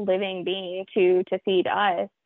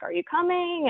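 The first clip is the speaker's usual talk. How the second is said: higher, gently questioning mock elk; elk calling back; 9 semitones higher than usual. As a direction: voice the herd asking her to follow, lightly. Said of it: "they were looking back at her like".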